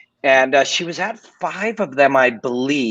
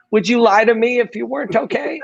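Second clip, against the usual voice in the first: wife's anxious demand; 7 semitones higher than usual. Retold or imitated. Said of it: "And she goes".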